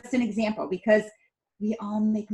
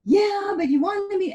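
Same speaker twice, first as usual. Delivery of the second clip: high, loud mock protest of a kid; imitating son; 12 semitones higher, 6 dB louder than usual. Mom imitating her son's complaint, high mock protest. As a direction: play the upset son, pitched up. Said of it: "And he's like".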